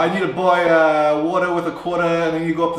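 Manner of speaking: hammed-up New York accent with dropped Rs. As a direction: attempt a New Yorker, dropping every R. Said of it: "That was my best".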